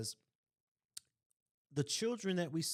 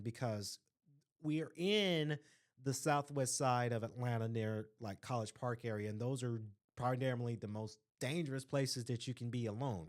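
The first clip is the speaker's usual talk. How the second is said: ordinary delivery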